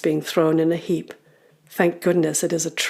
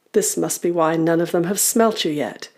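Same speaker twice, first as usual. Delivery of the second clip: ordinary delivery, nothing marked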